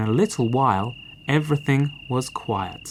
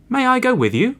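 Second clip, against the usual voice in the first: high animal voice; 11 semitones higher, 5 dB louder, faster than usual. Acted. Cow asks to join, high voice.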